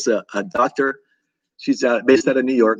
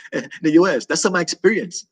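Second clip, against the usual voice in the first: normal talking voice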